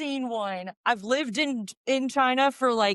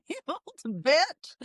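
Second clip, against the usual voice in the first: high, slow cranky granny insult; crotchety old woman sneering; old lady voice; 7 semitones higher, much slower than usual. Shown as a demonstration. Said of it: "the old ladies would not say bitch".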